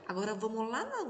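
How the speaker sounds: ordinary talk, nothing marked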